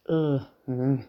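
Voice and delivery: muffled grunt from inside a helmet. A narrator's reading. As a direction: mumble through the helmet. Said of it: "kuului Jimin kypärän sisältä". (vaimeaa mutinaa kypärän sisältä)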